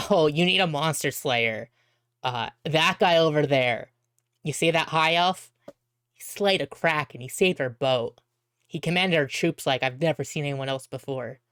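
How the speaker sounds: ordinary talk, nothing marked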